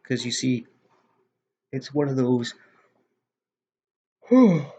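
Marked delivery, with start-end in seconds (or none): 4.3-4.6 big loud yawn. Real, not acted. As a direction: let out a long yawn. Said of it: "I'm yawning a lot all of a sudden".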